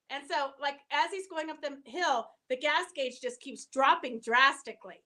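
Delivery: normal talking voice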